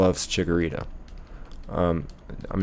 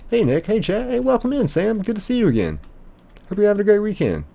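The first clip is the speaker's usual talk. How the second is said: cheery greeter; 13 semitones higher than usual. Retold or imitated. Reading a shop greeting from chat.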